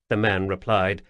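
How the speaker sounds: ordinary delivery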